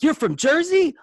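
high, loud thrilled disbelief; excited. Retold or imitated. Stranger in Oregon hears you're from Jersey, high excited squeal. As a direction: squeal it like meeting a fellow Jerseyan. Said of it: "You get excited".

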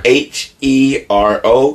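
H E R O spelled slowly, letter by letter; H, E, R, O, each set apart; emphatic spelling. Shown as a demonstration. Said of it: "I wanna emphasize the word".

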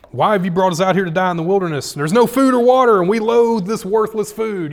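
loud, high whining complaint, sliding up into a near-shout; whiny complaining crowd. Acted. Preacher mocking the grumbling Israelites with a loud whine.

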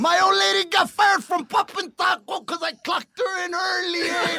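high, shouted tall tale; hammed-up, laughing holler; mock random rant. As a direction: imitate the friends' teasing routine. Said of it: "you'd make up this random story".

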